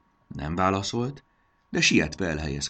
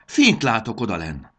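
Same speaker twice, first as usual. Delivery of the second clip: raised, urgent shout of discovery; excited cry; 6 semitones higher, 9 dB louder than usual. A narrator's reading. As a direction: call it out high and loud. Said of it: "kiáltott Fanny". (izgatott, hangos kiáltás)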